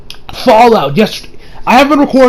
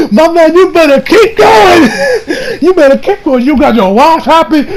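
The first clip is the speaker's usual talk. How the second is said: higher, louder cheering through laughter; laughing hype; 10 semitones higher, 4 dB louder than usual. Real, not acted. Reviewer urging Gohan on, laughing high cheer. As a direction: cheer him on while cracking up.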